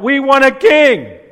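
high, loud, slow insistent shout; crying out. Acted. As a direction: yell it as a crowd demanding a king. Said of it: "And they cried out".

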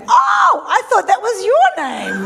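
high, loud mock-innocent retort; instant comeback. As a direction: fire back with a high, exaggerated "Oh". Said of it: "I just instantly said back".

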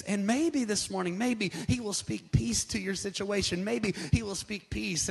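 normal talking voice

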